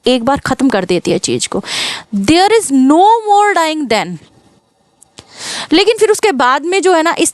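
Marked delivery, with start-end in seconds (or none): 2.2-4.2 reading the poem line very slowly, word by word; slow recitation. Shown as a demonstration. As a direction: dwell on each word of the verse. Teacher quotes sonnet line. (कविता की पंक्ति बहुत धीरे पढ़ना)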